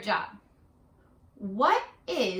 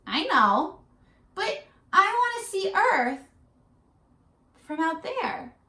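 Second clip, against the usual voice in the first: put-on child voice; 9 semitones higher, 4 dB louder than usual. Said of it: "May answers her mother".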